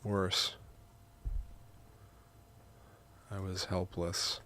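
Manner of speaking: measured, soft reading with long pauses; memoir narration. A narrator's reading. Writer narrating grandma's visit, measured memoir reading. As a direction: read it quietly, phrase by phrase. Reads own memoir aloud in takes.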